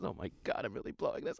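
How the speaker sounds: whispered groan to himself; panicked whisper. Retold or imitated. Son whispering in panic as the date goes wrong. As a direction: breathe it out in a hushed panic. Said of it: "He says".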